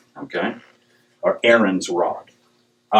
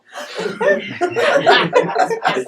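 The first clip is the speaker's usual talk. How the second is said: high laugh. Real, not acted. Big laugh.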